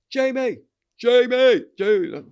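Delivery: high, loud calls across a room; calling out. Retold or imitated. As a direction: call the name to get attention. Someone calling name across room.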